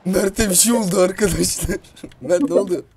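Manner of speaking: high, loud alarmed yelling; startled shout. Real, not acted. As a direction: panic and shout at the sudden fright. Sudden scare on the mountain.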